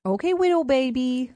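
put-on baby-talk back to a partner. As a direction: coo it like talking to a baby. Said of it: "if he baby talked back".

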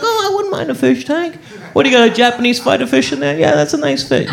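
high-pitched mock interest; admiring guest. Guest admiring someone's fish tank, high mock-admiring voice.

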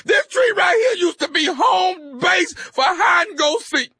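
hammed-up sobbing shout, pitch pushed up; fake crying. Prank caller crying over his mama's tree, fake sobbing shout. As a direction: wail it like a grown man faking tears. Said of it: "Are you crying?".

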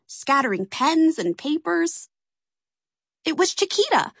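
ordinary delivery, nothing marked

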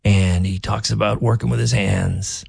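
lightly voiced rough-hewn Maine guy; a hint of a gruff working man talking; imitating Graham Plattner. Mocking the Senate candidate's rough working-man voice, lightly done. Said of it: "he talks like this. He's got a voice like this".

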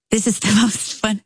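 ordinary talk, nothing marked

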